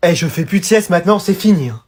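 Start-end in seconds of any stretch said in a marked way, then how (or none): none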